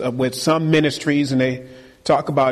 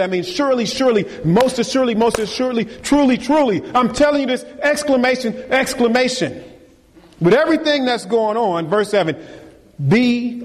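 raised, emphatic preacher build; rousing preaching; 6 semitones higher than usual. Acted. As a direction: hammer home each repeated phrase.

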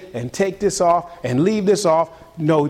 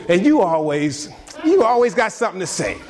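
mock spouse attacking; 5 semitones higher than usual. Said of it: "now I'm getting angry".

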